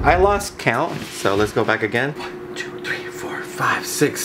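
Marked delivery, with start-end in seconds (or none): 2.1-4.2 whispered counting under the breath; whispering. Real, not acted. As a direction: count softly to yourself in a whisper. Quietly recounting the snakes.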